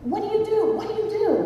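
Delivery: high, urgent imitation of a seatmate; high-pitched insistent questioning; frantic distraction. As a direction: mimic a desperate man making small talk. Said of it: "trying to think of what he can discuss".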